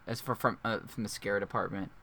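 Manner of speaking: usual speaking voice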